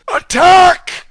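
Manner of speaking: high, loud shout to attack; battle cry. Acted. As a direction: bellow the order to attack. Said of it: "cried Aragog".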